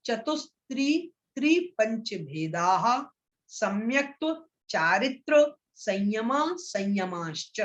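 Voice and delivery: slow sutra recitation, phrase by phrase with pauses; pronunciation demo. Shown as a demonstration. Teacher models the sutra for students to repeat, slow careful pronunciation. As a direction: recite slowly, drawing out the long vowels in संयमाश्च. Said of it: "अब मैं इसका उच्चारण कर रहा हूं".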